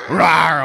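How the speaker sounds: loud playful tiger roar; mock big-cat roar. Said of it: "I need a little help with my tiger roar".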